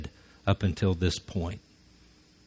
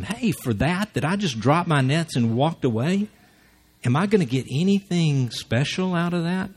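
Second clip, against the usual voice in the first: whiny Peter; 6 semitones higher than usual. Retold or imitated. Peter voiced, wanting reward.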